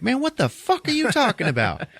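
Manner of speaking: high-pitched mock disbelief; incredulous outburst. Rod to his buddy about the hypnosis, incredulous. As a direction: act out a friend who can't believe it.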